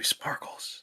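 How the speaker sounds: breathy whispered line. Retold or imitated. Whispering like the Twilight vampire. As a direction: breathe it out dramatically. Mocking Edward Cullen line.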